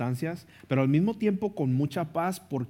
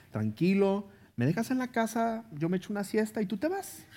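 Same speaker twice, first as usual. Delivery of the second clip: lightly voiced wife; 8 semitones higher than usual. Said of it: "luego me dice".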